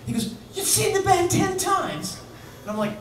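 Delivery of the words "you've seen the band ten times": high, slow, astonished question; amazed. Retold or imitated. [name] marvelling that [name] saw the band ten times. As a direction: stretch it out in disbelief.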